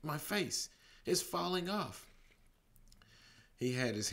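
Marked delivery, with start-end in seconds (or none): 0.0-2.1 high, frightened shriek. Acted. Manny shrieking at his falling face. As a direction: shriek it out in horror. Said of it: "Manny shrieked".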